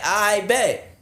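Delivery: very high, smug "I bet"; sarcastic. Retold or imitated. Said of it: "I'm like".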